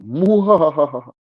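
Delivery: sneering villain cackle, high and loud; Doctor Evil laugh. Pretend. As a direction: mwahaha like a panto villain. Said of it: "you gotta do the Doctor Evil, Evil laugh with your pinky up".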